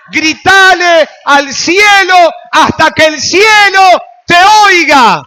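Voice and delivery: all-out pulpit scream; full shout. Real, not acted. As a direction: shout it to heaven with everything. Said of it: "Grita más fuerte".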